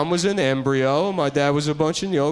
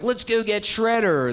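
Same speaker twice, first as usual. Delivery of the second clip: high, drawn-out mock turtle rallying cry; rally the team like a cartoon hero; 8 semitones higher than usual. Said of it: "the turtles are like".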